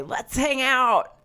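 high, drawn-out mock invitation; overeager, stretched-out fan voice; imitated eager fan. Imitating fans after shows, high slow mock eagerness. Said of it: "like, say".

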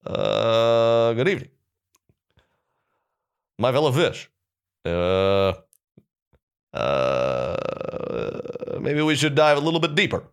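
halting presidential address with long uhs; measured statesman, pausing between phrases; President Obama. Acted. Fish addressing his fellow fish, presidential impression. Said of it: "President Obama".

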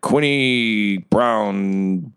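very slow, unsure reading of the name. Acted. Clerk squinting at the file, reading the name very slowly. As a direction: drag it out as if squinting at it. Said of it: "I don't know".

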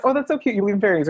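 high, condescending coo; patronizing gush. Retold or imitated. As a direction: talk down sweetly to a believer. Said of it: "It wasn't like".

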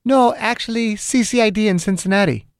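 high-pitched heroine's voice; woman character's voice. Acted. Margaret tells Doctor Chang where she works, high woman's voice. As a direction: pitch it well up, as the female caller. Acted heroine answers on phone.

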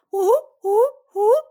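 three high cheering whoops; celebratory whoop. Pretend. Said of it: "Okay, three".

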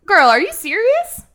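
put-on high, loud, disbelieving "Girl"; mock incredulous Chappelle. Chappelle to the hostess, high and incredulous. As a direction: throw it back at her, amazed.

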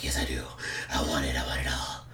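put-on low, soft gravel-free growl of an old boss; Vince McMahon impression. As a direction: grumble it low and hungry like Vince. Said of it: "having too much fun with this Vince McMahon character".

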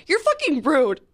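acted-out outraged comeback; retold anger. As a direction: replay telling someone off, voice lifted. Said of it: "I was so angry".

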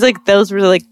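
usual speaking voice

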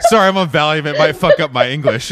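laughing quote. Retold or imitated. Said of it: "I literally thought you're like".

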